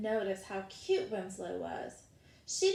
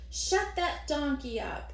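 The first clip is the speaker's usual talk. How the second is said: calling out; 5 semitones higher, 4 dB louder than usual. Acted. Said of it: "called out".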